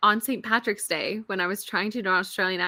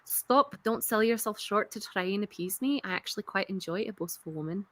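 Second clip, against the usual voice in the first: put-on Scottish accent; 3 semitones lower, 7 dB quieter than usual. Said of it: "I'll just do the last bit".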